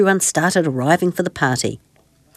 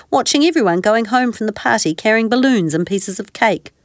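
plain reading voice

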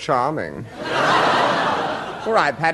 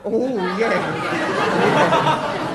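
ordinary talk

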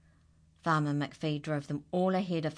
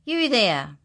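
loud, higher call; shout; 5 semitones higher, 10 dB louder than usual. Acted. Angry farmer shouting at dancing animals. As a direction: bellow it across the yard. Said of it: "shouted Farmer McPhee".

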